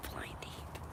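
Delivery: Blind Eep breathed in a voiceless whisper; whispered taunt. Real, not acted. Muttering at the dog Eep, whispered taunt. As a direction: hiss the same insult under your breath. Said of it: "that stupid dog, you know, pissed me off".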